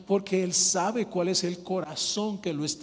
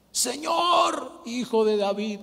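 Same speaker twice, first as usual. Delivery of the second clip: high, loud cry of "Señor"; shouted call; 5 semitones higher, 4 dB louder, slower than usual. Acted. Bartimeo proclaiming Jesus Messiah, loud high shout. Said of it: "él gritó".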